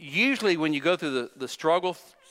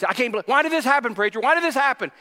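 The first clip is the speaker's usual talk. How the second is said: agitated disbelief; 6 semitones higher, 3 dB louder, faster than usual. Retold or imitated. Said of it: "And he was".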